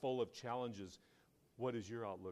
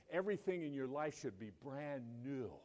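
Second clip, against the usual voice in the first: usual speaking voice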